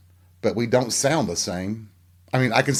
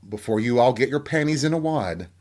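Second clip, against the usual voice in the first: normal talking voice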